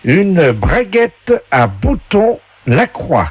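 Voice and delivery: high, slow showman voice reading the item; game show announcer voice. Pretend. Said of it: "la voix du jeu".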